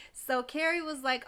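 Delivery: normal talking voice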